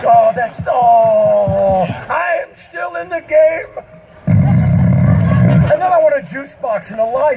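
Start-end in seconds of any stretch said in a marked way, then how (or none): 0.0-3.7 loud hammed-up old man exclaiming; mock a geezer crowing he's still got it; loud, slow mock excitement; mock excited cheer. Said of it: "most of the world goes".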